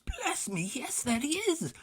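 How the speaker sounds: put-on thrilled to spot his old friend; high excited voice; delighted Scrooge. A narrator's reading.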